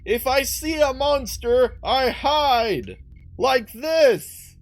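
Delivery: high-pitched drawn-out call from afar; play-acted high, slow holler down the road. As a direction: call across a distance, stretching the words. Character calls back from along road.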